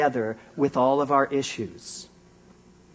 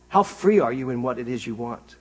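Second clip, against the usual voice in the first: ordinary delivery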